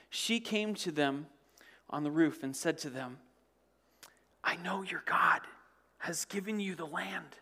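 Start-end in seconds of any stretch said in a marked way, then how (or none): 4.4-7.4 hushed. Acted. Rahab whispers to the spies.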